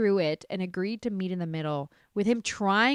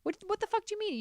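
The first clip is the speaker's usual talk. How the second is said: incredulous outburst, pitch way up; outraged; 14 semitones higher, much faster than usual. Real, not acted. Demanding an answer from the absent husband, outraged.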